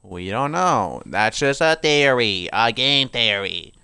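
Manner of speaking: slow, loud drawn-out show sign-off; stretched-out mock-dramatic announcing; catchphrase parody. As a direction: deliver it like a hammy video host. Quotes a catchphrase in announcer style.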